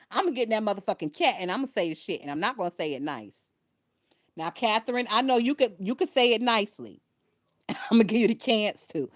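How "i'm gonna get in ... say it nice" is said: rapid threat. Real, not acted. Threatening to go into the chat.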